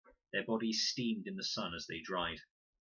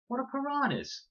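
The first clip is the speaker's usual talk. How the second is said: high, alarmed question; frightened girl in the water; panicked question; 5 dB louder than usual. A narrator's reading. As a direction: ask in rising panic. Con panicking in the river.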